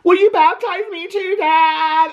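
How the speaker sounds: high, squeaky pleading imitation; mock tearful daughter. Brescia asking Dad to baptize her, mock tearful plea. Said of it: "Brescia's crying and she's like".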